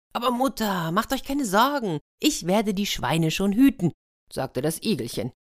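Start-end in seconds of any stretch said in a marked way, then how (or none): 0.1-3.9 higher-pitched, eager son's voice; confident little hedgehog. Acted. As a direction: reassure mother in a small creature's higher voice. Plays hedgehog reassuring his mother.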